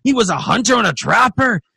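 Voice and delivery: high, loud, boisterous dwarf; dwarf insisting. Acted. Another dwarf talks up the dead hunter, boisterous and high. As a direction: talk him up in a rowdy dwarf voice. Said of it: "And then there's another one".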